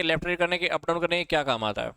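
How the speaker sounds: ordinary talk, nothing marked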